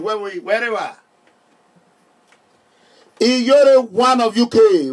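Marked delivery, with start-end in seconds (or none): 3.2-4.9 slow, loud announcing of the name; drawn-out call. Real, not acted. Proud father announcing his daughter's name to viewers, slow and loud. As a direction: stretch the name out like calling it across a room.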